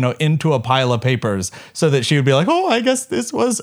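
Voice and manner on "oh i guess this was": high, mock German-accented teacher; teacher's German accent. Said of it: "I shouldn't do the accent".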